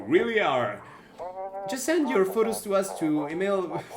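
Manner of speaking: TV show host. Acted. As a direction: sell the program like a game show presenter. Reads a TV show host's pitch.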